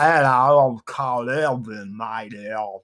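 mangled, put-on Cockney accent; Karl Urban's bad accent. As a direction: drag out a badly faked Cockney accent. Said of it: "Karl Urban's accent".

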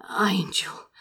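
unvoiced whisper. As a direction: breathe the name as a whisper. Lover near climax whispers.

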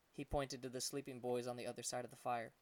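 usual speaking voice

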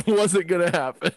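ordinary talk

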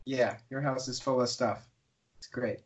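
soft, unimpressed agreement; humouring reply. Retold or imitated. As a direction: agree quietly without conviction. Humouring the boastful villager.

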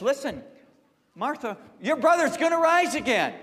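high, loud proclamation of hope; voicing Jesus. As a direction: announce the promise to Martha with force. Preacher voices Jesus comforting Martha.

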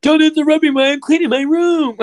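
very high, loud whiny shout; Dewey movie quote. Pretend. Friend shouting a Scary Movie quote. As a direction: screech it like a movie kid.

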